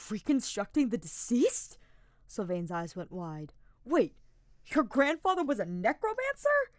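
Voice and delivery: high, loud, wide-eyed alarm; shocked young knight. A narrator's reading. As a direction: blurt it out in shock. Sylvain shocked by the news.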